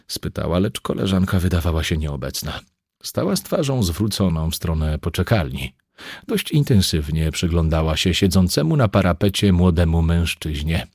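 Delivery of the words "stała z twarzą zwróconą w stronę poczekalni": ordinary talk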